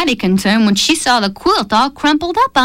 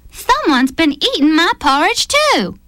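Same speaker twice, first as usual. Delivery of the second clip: higher, fretful mother bear voice; fretting Mama Bear; 7 semitones higher than usual. A narrator's reading. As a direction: worry over it like a fussy mama bear. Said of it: "she fretted".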